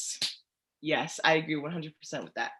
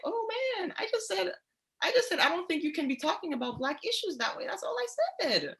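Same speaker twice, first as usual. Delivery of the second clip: much higher, pleading and incredulous; exasperated student; 11 semitones higher than usual. Retold or imitated. Student protesting to the teacher, high exasperated whine. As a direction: play the baffled kid defending himself. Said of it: "you're just there like".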